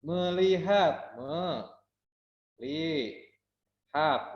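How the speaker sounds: me-li-hat split slowly into syllables. Shown as a demonstration. Teacher to class, spelling out syllables slowly. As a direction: drag out "melihat" syllable by syllable. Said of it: "berarti tiga suku kata".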